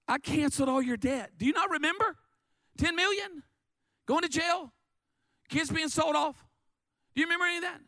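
high, incredulous scolding questions; outraged rebuke. Acted. Master confronts the wicked servant he forgave, outraged rebuke. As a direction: pitch it high and fire off indignant questions.

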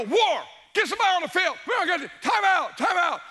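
high, loud, rushed shouting at the assistant; frantic sideline yell. Retold or imitated. Coach screaming at his assistant coach, panicked shouting. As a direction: bellow across the field in a panic, fast.

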